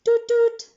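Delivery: high, loud imitated car horn; taxi hoot. A narrator's reading. Taxi startles Jamila with its horn, hooting. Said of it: "a taxi hooted".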